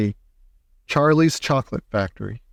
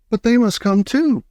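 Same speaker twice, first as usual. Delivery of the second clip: high, louder firm urging; insistent; 10 semitones higher, 3 dB louder than usual. Acted. Wonka insisting the family comes, high eager plea. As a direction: press the point, pitched up.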